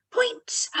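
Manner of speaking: sudden high bright call of point; spring the word point on them; excited cue. Shown as a demonstration. Teacher cues children to point, excited. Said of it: "teacher cueing children to point".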